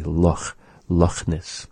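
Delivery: "Loch" ending in a throaty rasp; Scottish raspy CH. Shown as a demonstration. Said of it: "that they say".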